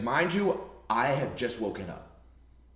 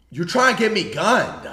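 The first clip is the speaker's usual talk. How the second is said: raised, louder disbelieving cry; incredulous outburst; 7 semitones higher, 8 dB louder than usual. Retold or imitated. To the cop on the phone, a loud high incredulous outburst. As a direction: blurt it out, high and loud, like you can't believe it. Panicked reaction to cop's orders.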